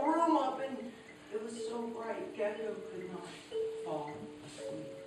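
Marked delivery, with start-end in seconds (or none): none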